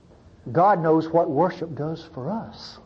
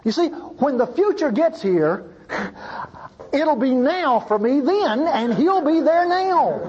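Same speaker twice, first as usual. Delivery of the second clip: bolder preaching; 8 semitones higher than usual. Real, not acted. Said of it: "And I'm gonna be ugly and bolder".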